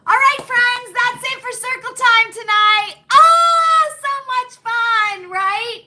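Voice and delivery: high, loud, bubbly farewell; cheery sign-off. Real, not acted. Host cheerfully closing circle time for her friends, bubbly and loud. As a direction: sing out the goodbye with a big oh.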